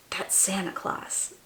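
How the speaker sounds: hushed, breathless whisper of a secret; excited whisper. Pretend. Reader whispering to children who has arrived, hushed whisper. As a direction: let them in on a thrilling secret.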